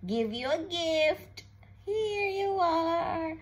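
ordinary delivery

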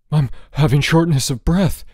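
loud, high, breathless panic; gasping. A narrator's reading. George believing he is sick, gasping. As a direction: gasp it out as if short of air. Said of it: "he gasped".

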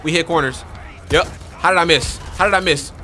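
usual speaking voice

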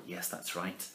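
ordinary delivery, nothing marked

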